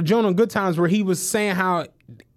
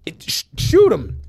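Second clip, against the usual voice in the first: normal talking voice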